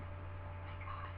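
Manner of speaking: very soft, slow whisper; hushed fangirl awe; awestruck whisper. Retold or imitated. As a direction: whisper it slowly in disbelief. Awestruck after touching wrestler's arm.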